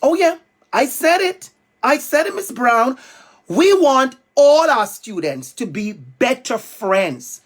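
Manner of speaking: usual speaking voice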